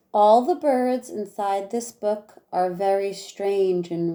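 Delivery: lively rhyming read-aloud for a class; reading to children. Acted. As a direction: read each verse with bounce for the kids. Teacher reads rhyming picture book aloud.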